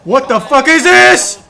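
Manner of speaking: loud, drawn-out, high disbelief; slow furious what-is-this; exasperated outburst. Real, not acted. Streamer reacts to bad play.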